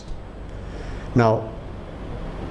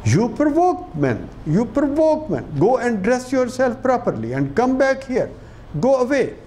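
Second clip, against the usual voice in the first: hector her in a pitched-up mock yell; 8 semitones higher than usual. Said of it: "yells at her and says".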